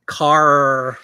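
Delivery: "Car" drawn out with a long hard R; mock-accent "Car", R held; regional R. Shown as a demonstration. Mimicking regional pronunciation, laughs after.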